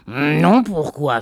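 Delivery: puzzled husband. A narrator's reading. Husband answers wife's question.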